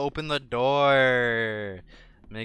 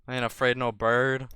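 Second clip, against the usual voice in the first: ordinary talk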